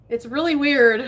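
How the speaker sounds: laugh, then say it loudly with a high pitch. Real, not acted. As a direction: burst out laughing, amused. Laughs at weird emails.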